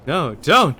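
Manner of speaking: loud desperate no; pleading shout. Acted. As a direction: cry out to stop them. Skeleton tries to stop theft.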